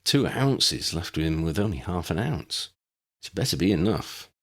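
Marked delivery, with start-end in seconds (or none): none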